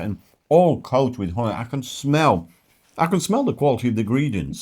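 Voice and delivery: ordinary talk, nothing marked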